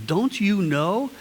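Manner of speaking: "you" held long and rising in "Don't you know?"; stress on you. Shown as a demonstration. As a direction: lean on "you" slowly. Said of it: "and the "you" there".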